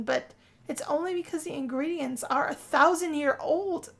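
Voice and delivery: normal talking voice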